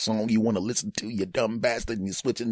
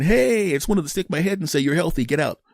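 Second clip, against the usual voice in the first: high, breezy doctor voice; 9 semitones higher, 3 dB louder than usual. Retold or imitated. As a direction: brush off a healthy patient. Doctor rushing past patient.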